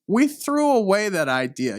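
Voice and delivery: ordinary talk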